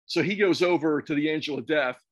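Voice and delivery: normal talking voice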